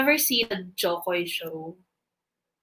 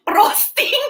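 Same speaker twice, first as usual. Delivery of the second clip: "Frosting" mocked much higher and louder, through laughter; imitating Shalea; 14 semitones higher, 4 dB louder than usual. Teasing a friend about her word, high mock imitation. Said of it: "Sabi ni Shalea".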